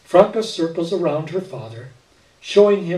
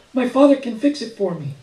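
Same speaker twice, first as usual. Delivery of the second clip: put-on lightly raised child voice, eager; a small girl asking hopefully; little girl voice; 8 semitones higher than usual. A narrator's reading. Little girl Franca speaks.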